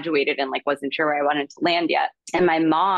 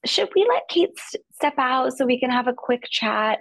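voicing Marnie; 7 semitones higher than usual. Marnie quoted to casting room.

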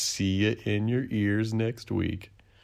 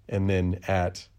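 ordinary talk

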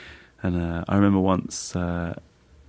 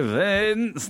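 a much higher drawn-out call of the name; weird voice; 13 semitones higher than usual. Retold or imitated. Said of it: "do this weird voice".

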